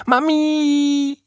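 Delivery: put-on very high, squeaky baby-creature call; peeping slime creature. A narrator's reading. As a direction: peep it like a little blob calling for mummy. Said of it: "piepste der Schleimhaufen". (hoch piepsende Schleimstimme)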